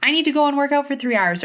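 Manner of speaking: hammed-up anxious inner voice; mock panic. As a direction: rush it like a frantic self-talk. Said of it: "from this space of panic and desperation and fear".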